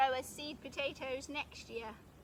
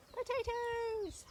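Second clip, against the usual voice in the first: a high, slow, sung-out call of delight; drawn-out cheer; 9 semitones higher, much slower than usual. Real, not acted. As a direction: announce the harvest like a little fanfare. Triumphant call at the harvest.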